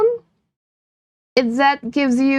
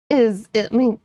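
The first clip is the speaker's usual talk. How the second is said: normal talking voice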